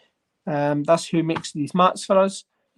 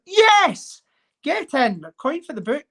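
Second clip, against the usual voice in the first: excited cheer; 9 semitones higher, slower than usual. Real, not acted. Collector celebrating a coin find for the book, jubilant whoop. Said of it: "I'm getting all excited about a coin".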